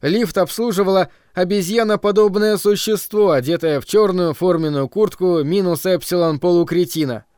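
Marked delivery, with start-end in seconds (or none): none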